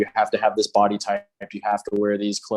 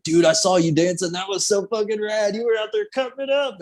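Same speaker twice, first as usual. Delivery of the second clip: much higher, hyped-up buddy voice; excited praise; 10 semitones higher than usual. Retold or imitated. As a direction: gush like a stoked stranger. Videographer quoted praising his dancing.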